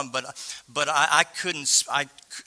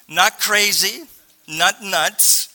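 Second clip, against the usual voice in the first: usual speaking voice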